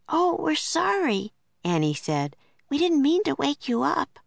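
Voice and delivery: put-on high, small girl's hurried sorry; apologetic Annie. A narrator's reading. Annie to the angry mother kangaroo, high and apologetic. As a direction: soothe the glaring kangaroo in a high young voice.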